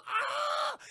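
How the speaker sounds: very high-pitched comic shriek; mock scream. Girl sees the cursed captain, mock shriek. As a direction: imitate her terrified scream. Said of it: "she just goes".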